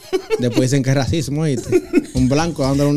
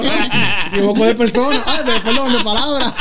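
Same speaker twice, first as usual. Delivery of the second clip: laughing; 6 semitones higher than usual. Real, not acted. Teasing slip of the word.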